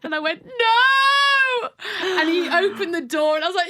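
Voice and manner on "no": a sudden high loud wail of no; anguished cry of no. Retold or imitated. Answering her partner through the door with a loud, broken cry. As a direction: cry out no, at the end of her rope. Partner asks if she's all right.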